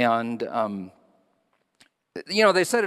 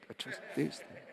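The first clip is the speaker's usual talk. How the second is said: hushed aside; 3 semitones higher, 13 dB quieter than usual. Real, not acted. Soft aside after joke.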